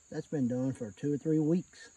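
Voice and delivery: ordinary talk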